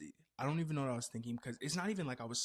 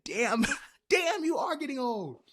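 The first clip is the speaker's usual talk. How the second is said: mock fan teasing; 13 semitones higher, 8 dB louder than usual. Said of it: "what if you guys said".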